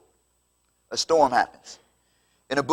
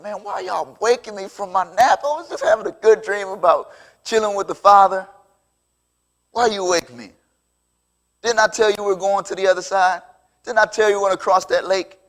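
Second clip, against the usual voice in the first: higher-pitched comic complaint; playful, hammed-up exasperation; mock grumbling; 5 semitones higher than usual. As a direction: act put out at being woken from a nap. Imagined Jesus grumbles at being woken.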